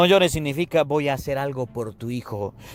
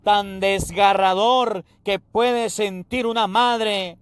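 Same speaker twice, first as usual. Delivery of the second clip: impassioned preaching; 8 semitones higher than usual. Acted. Preacher to his congregation about a grieving mother, impassioned preaching. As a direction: pour the grieving mother's pain out over the church.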